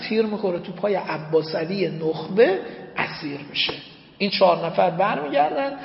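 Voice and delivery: usual speaking voice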